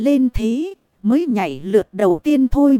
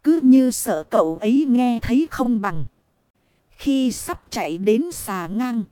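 ordinary delivery, nothing marked

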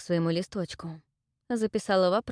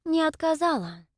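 lecturing; 9 semitones higher than usual. Acted. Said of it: "назидательно ответила Салина".

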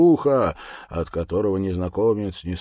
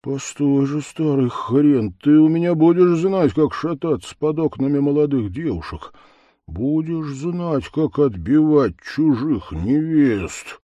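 vengeful threat; 7 semitones higher, 6 dB louder than usual. Acted. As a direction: promise payback with relish. Левко vows revenge.

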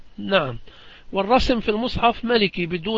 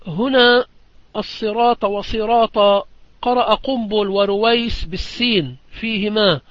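normal talking voice

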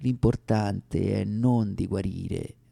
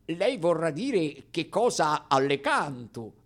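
lightly voiced professor objecting; imitating the professor; 6 semitones higher than usual. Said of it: "disse lui".

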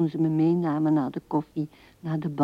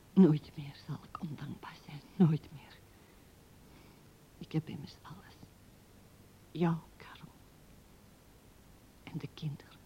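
hushed, breathy whisper of a private promise; barely voiced, inward whisper; whispered vow; 12 dB quieter than usual. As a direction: whisper it like a secret oath to yourself. Recalled private vow, spoken inwardly.

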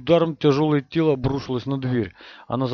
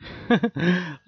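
pleased, nasty little laugh; a gloating chuckle; smug chuckle; 6 semitones higher than usual. Acted. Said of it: "Довольный смешок Года прозвучал омерзительно".

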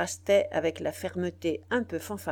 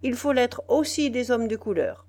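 higher, louder severe correction; stern; 5 semitones higher, 6 dB louder than usual. A narrator's reading. Biassou correcting the citizen, stern and loud. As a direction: press hard on aussi. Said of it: "répartit sévèrement Biassou".

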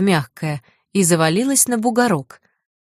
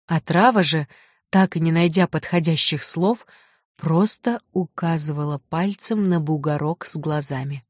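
ordinary delivery

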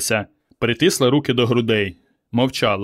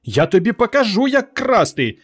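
very high, threatening scolding; high-pitched maternal fury; mother scolding; 10 semitones higher than usual. A narrator's reading. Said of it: "чехвостила мати сина".

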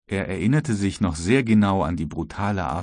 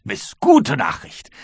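much higher and slower, savoring "gute Nachricht"; second call, drawn out and higher; gleeful repeat; 12 semitones higher, 6 dB louder, slower than usual. Acted. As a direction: stretch out the good news with glee. Croxton repeats good news.

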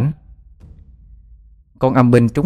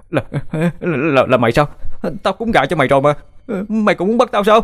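stammering, much higher panicked voice; cornered and begging, voice cracking upward; terrified; 8 semitones higher than usual. A narrator's reading. Bích crawls back from the demon, terrified stammer.

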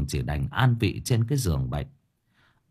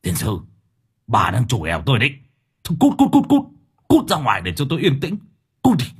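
put-on loud, fast furious old man yelling get out; angry husband; 2 semitones higher, 4 dB louder, faster than usual. A narrator's reading. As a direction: drive her out in a rage. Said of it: "chỉ vào bà gằn giọng". (ông chồng quát đuổi vợ giận dữ)

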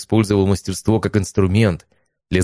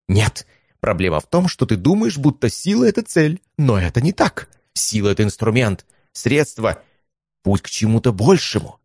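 lecturing mentor voice; 5 semitones higher than usual. Acted.

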